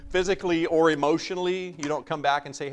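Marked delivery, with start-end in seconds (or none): none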